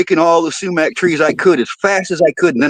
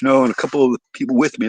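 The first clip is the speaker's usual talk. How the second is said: usual speaking voice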